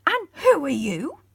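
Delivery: gruff wizard, loud and suspicious; stern demand. A narrator's reading. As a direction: glare and demand who they are.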